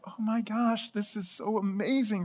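high, soft gushing delight; gushing girls. Retold or imitated. Girls on staff receiving flowers, high soft gushing. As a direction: coo it like a thrilled young woman.

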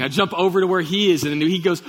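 ordinary talk

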